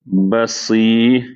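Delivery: بصیر stretched out very slowly; drawn-out demo. Shown as a demonstration. Teacher drawing out the word for students, very slow. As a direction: drag out بصی like a recitation teacher. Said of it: "بصیر".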